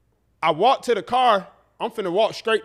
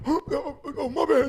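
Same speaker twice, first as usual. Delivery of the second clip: put-on higher, groggy drunk mumble; drunk slurring uncle; 7 semitones higher than usual. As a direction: play the just-woken drunk uncle. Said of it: "halfway talking and drunk".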